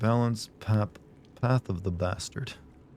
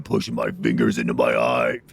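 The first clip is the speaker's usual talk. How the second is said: pained shout; 10 semitones lower, 4 dB louder than usual. Real, not acted. Sudden pain poking own eye.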